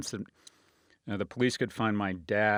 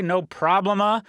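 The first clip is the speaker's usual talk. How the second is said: mock Spanish; 9 semitones higher, 8 dB louder than usual. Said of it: "or as they say".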